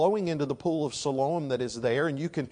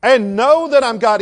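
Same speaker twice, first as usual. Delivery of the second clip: full-force booming command, very high and loud; fortissimo shout; 10 semitones higher, 12 dB louder, slower than usual. Shown as a demonstration. Preacher showing God's fortissimo command, shouting. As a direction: thunder it out like God commanding. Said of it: "This is fortissimo".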